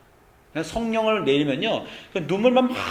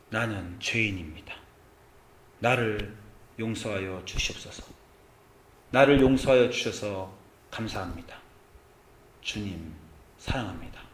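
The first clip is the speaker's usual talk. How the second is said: 1 humble prayer; 6 semitones lower, 6 dB quieter than usual. Acted. Pastor voicing repentant woman's prayer.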